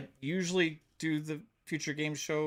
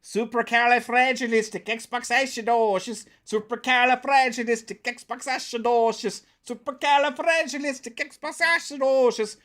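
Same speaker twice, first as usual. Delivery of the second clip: high, lilting mock-Irish accent; playful stage-Irish, pitched up; 8 semitones higher than usual. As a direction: rattle off the long word in a hammed-up Irish accent. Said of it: "can I say it in an Irish accent?".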